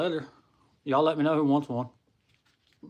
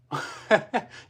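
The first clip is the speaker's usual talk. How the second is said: quick high laugh; real laugh; 8 semitones higher than usual. Real, not acted. Streamer laughing at chat's odd question, quick laugh.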